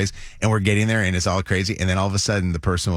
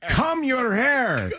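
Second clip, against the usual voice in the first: high, drawn-out dad impression with Spanish accent; much slower than usual. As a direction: call out slowly in a Spanish accent. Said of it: "dad having a Spanish accent".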